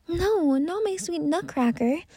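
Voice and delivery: high, understated cry of alarm; mild mock scream. A narrator's reading. Maria pleading for her sweet Nutcracker, mildly mock-screamed. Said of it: "screamed Maria".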